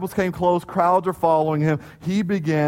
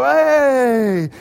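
long drawn-out, louder "hey"; rallying cheer; 8 semitones higher, 5 dB louder than usual. Retold or imitated. Junior high boys starting a stadium cheer, rallying cheer. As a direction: holler it out and stretch it. Acting boys' stadium wave shout.

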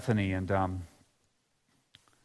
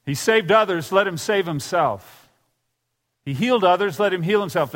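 higher, louder jeering taunt; mocking insults; 6 semitones higher, 4 dB louder than usual. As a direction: sneer at him the way passers-by did. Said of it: "they hurled insults at him".

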